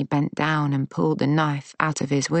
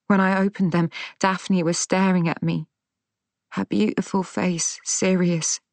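usual speaking voice

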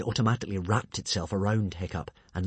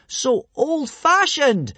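very high, loud stretched cry; hurried shriek; 8 dB louder, much slower than usual. A narrator's reading. Hiccup stopping the monster from squeezing, panicked shriek. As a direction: shriek it in alarm. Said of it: "shrieked Hiccup hurriedly".

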